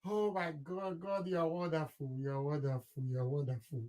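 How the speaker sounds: quiet, moved praise; emotional prayer. Real, not acted. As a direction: breathe the words out, overwhelmed. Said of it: "I'm so emotional right now".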